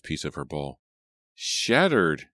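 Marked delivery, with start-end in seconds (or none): 1.3-2.3 high, drawn-out lament; dismayed and sorrowful; sad. A narrator's reading. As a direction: mourn the word, pitch rising then falling. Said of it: "she said sadly".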